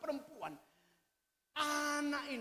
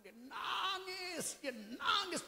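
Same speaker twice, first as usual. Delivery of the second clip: crying out; 8 semitones higher, much slower than usual. Retold or imitated. Hannah weeping before God.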